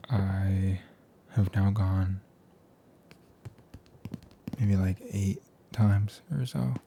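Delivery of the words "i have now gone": ordinary talk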